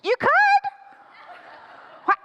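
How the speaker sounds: very high, squeaked hedge; reluctant stalling. Acted. Dad asks to come in, squeaky reluctant stalling. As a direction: squeak it out, unwilling to agree.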